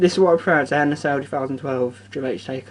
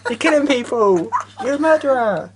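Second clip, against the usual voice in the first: imitating Sean; 7 semitones higher, slower than usual. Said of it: "Sean went".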